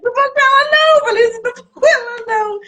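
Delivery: very high, laughing-crying mock wail; wailing refusal. Girl refusing to go with the woman, wailing in mock panic. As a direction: refuse in a squealed, hammed-up panic.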